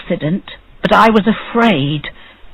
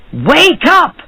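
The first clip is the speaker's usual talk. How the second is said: high, angry shout at the doorstep; 10 semitones higher than usual. A narrator's reading. Rich man shouting at the dwarf on his doorstep, angry and high. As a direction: bark it high and sharp. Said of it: "he shouted angrily".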